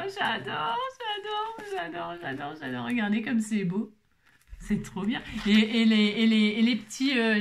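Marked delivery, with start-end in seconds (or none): 0.0-2.9 excited squeal. Real, not acted. Gushing over craft supplies.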